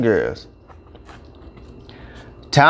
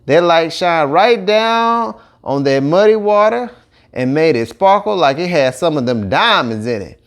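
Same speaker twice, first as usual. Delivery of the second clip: usual speaking voice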